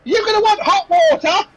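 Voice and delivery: very high-pitched shrieked afterthought; put-upon serving girl, voice pitched right up; exasperated barmaid. Acted. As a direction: call back grudgingly to the guests. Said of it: "she turns around and she goes".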